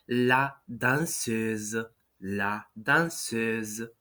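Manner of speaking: la danseuse slow, with a buzzing final S; French la danseuse. Shown as a demonstration. Teacher models the feminine dancer form. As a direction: stretch out la danseuse very slowly. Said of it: "translate to feminine form".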